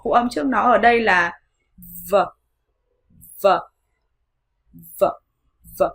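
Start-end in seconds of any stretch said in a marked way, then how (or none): 2.1-5.8 "V" as a short buzzing consonant alone; isolated V consonant. Shown as a demonstration. Teacher isolating the consonant before silent E, "V" short. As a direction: give just the brief sound of "V". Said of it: "để ý đến phụ âm ở trước nó".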